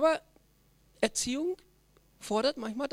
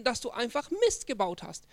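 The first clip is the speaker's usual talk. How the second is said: ordinary talk, nothing marked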